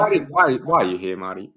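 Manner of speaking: loud, high-pitched protest, stammering on why; mock indignation. Host confronting Martin Scorsese at mock awards, feigned indignation. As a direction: play at being outraged by an unwelcome guest. Mock scolding of an unwanted guest.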